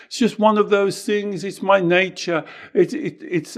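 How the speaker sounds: high, fast whining self-pity; parodying someone playing the victim; mock whiny victim. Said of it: "You see how you're the victim?".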